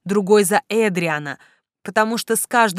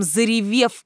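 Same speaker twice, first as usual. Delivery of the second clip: ordinary delivery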